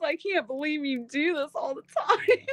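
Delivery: imitating husband while laughing; laughing too hard to quote him straight; quoting through laughter. Wife retelling husband's fasting complaint, laughing. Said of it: "He says".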